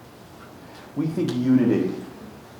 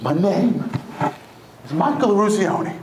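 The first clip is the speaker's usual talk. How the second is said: imitated outburst, pitched up; mild retold scream; 9 semitones higher, slower than usual. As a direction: cry it out in halves, with a pause. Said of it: "one player scream out".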